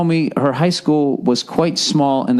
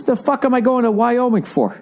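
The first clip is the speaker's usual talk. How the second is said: usual speaking voice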